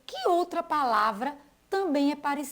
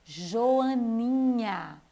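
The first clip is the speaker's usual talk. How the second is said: drawn-out word; much slower than usual. Shown as a demonstration. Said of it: "Som inicial também é jó".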